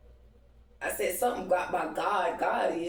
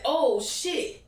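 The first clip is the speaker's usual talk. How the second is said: sudden alarmed yelp, pitched up and louder; startled; 9 semitones higher, 4 dB louder than usual. Retold or imitated. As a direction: jump as if you just remembered something. Realizes crystal in hair.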